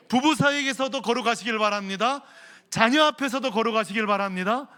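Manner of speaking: fervent blessing. Real, not acted. Pastor blessing the congregation at full voice. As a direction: pronounce the blessing with force.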